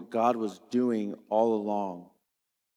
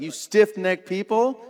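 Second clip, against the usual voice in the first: loud, higher, accusing rebuke; rebuking; 8 semitones higher, 6 dB louder than usual. Retold or imitated. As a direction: fling it at the leaders. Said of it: "he's stepped up and he's like".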